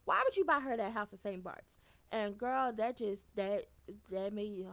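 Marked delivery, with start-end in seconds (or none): 0.0-1.8 loud fast exasperated yell; loud exasperation; outraged yell. Real, not acted. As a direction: shout at him for buying it. Outraged at character.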